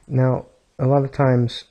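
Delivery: normal talking voice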